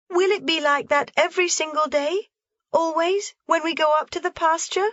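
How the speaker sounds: put-on high, hopeful girl asking; Heidi, longing. A narrator's reading. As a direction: plead for reassurance.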